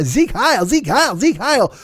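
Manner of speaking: high-pitched mock salute chant; Dick Shawn. Imitating an actor's comic Hitler, high mock chanting. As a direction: repeat it in a swooping, hammed-up chant. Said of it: "being Hitler and pretending to be like".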